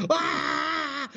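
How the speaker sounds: long, very high comic wail; mock terrified scream. Storyteller scared for his life before screaming kids, mock scream. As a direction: hold a high scream of pretend panic. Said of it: "Screaming, so I'm screaming".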